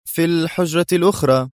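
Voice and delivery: ordinary delivery, nothing marked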